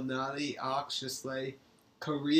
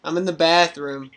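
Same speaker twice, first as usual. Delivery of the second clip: called out much louder, to another room; calling out; 2 semitones higher, 14 dB louder than usual. Real, not acted. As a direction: raise the voice so they hear. Repeats louder, not heard first time.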